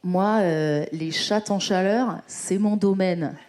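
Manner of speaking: low, self-satisfied boss voice; boss impression. Sleazy vet boss making his joke, low imitated voice. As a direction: drop low and slow down toward the end. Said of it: "il arrivait puis il était comme".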